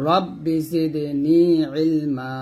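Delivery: রব্বি জিদনি ইলমা sung out slowly with a one-beat lengthening; slow Quranic recitation of রব্বি জিদনি ইলমা; one beat stretch. Shown as a demonstration. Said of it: "এক লিপ টান দিবেন এরকম".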